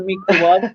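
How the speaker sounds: "microwave" as "meekro-wah-vay"; imitating odd pronunciation. Friend adopting the funny new word, "microwave" as "meekro-wah-vay". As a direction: turn "microwave" into "mee-kro-wah-vay". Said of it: "you can't say it any other way".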